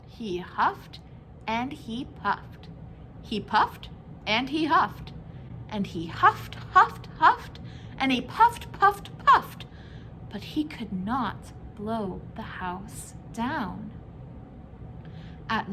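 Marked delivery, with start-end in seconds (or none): 6.1-9.7 high, loud huffing strain; straining wolf. A narrator's reading. Wolf straining at the brick house, high and loud. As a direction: push each word like a huff.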